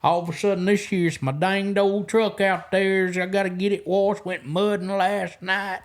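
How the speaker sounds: exaggerated put-on country accent, stretched out; cranked-up country accent. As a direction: lay the country twang on thick, making fun. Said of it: "they would just crank that accent up".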